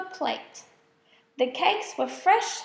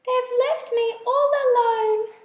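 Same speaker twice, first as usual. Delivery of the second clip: higher tearful lament, a touch of a sob; crying out; 8 semitones higher, 4 dB louder than usual. A narrator's reading. Lonely spider crying after the moths flee, higher tearful voice. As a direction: cry out forlornly, abandoned again. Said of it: "she cried".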